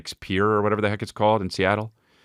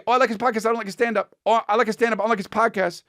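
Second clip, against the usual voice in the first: much higher mocking fan voice; high mock fan chatter; faster than usual. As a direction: whine it like fickle fans. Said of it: "a lot of people say".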